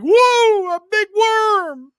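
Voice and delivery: very high, loud drawn-out yell; mock hallway yell. Imitating a classmate yelling in the hallway, loud high mock yell. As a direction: holler it down the hallway, stretching the Worm. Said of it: "he would often yell in the hallway".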